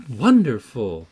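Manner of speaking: loud mock-admiring exclamation; exclaiming. A narrator's reading. Catherine reacting to the lad reading, exclaiming loudly. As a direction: burst out with it in a storyteller's voice. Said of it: "I exclaimed".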